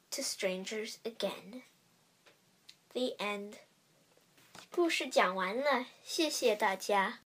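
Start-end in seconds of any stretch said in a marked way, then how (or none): 0.1-3.6 whisper. A narrator's reading. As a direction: hush the last words. Moral ending whispered.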